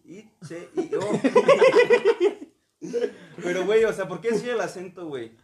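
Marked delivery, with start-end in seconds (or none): 1.1-2.4 high, loud cackling burst; loud laughter. Real, not acted. Friends laughing at spelling México, high loud laughter.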